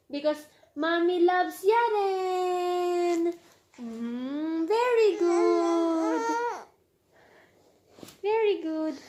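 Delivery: tender praise. Real, not acted. Praising toddler after hug.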